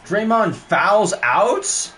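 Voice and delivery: can't believe the call; drawn-out disbelief; incredulous. Real, not acted.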